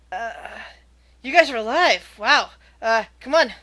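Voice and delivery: gnome soldier voice. Acted.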